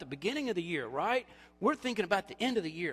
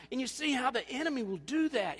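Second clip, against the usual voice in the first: ordinary talk, nothing marked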